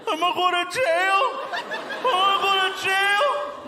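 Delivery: high, loud, whiny panic; mock panic. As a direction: wail it like a panicked friend facing jail. Friend panics about jail, retold.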